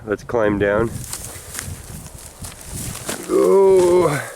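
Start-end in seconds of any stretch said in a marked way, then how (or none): 3.4-4.3 sudden high surprised oh; startled exclamation, pitched high. Real, not acted. Sudden find at the dump.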